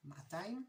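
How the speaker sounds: "Ma'atayim" with the first a held long; Jerusalem-style long opening vowel in "Ma'atayim"; lengthened first vowel. Shown as a demonstration. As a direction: draw out the Maa of "Ma'atayim". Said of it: "that's how you say it in Jerusalem".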